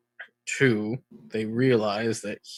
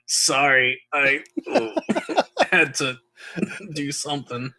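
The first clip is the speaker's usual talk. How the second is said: laughing apology; 4 semitones higher, 4 dB louder than usual. Pretend. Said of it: "I'm just gonna say".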